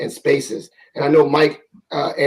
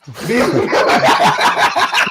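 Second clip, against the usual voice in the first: real burst of loud laughter; laughing; 4 dB louder than usual. Real, not acted. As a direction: crack up for a couple of seconds.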